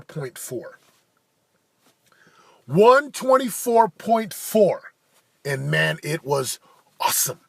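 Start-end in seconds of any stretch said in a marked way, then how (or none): 2.7-4.9 slow, louder, higher proud announcement; triumphant repeat. Real, not acted. Announcing his rowing time to viewers, slow and triumphant. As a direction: savor the number like a victory.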